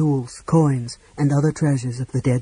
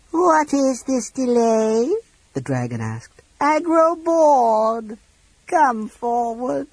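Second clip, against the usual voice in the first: high, slow, bored dragon voice; impatient dragon; 10 semitones higher, 4 dB louder, much slower than usual. A narrator's reading. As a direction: drawl the boredom out.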